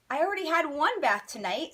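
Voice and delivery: cheeky. Acted. Wet boy's cheeky remark.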